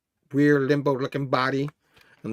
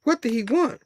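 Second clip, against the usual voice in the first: usual speaking voice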